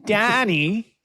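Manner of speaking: put-on high, loud Boston accent. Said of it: "Boston accent".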